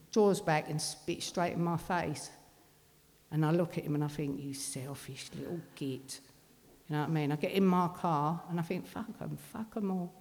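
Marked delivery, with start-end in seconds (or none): 4.4-6.2 very soft, low mutter; bitter inner thought. Retold or imitated. Mother thinking at the son who spat, low bitter mutter. 8.8-10.1 much softer, muttered dismissal; dismissive inner thought. Retold or imitated. Mother leaving in her car, quiet dismissive mutter.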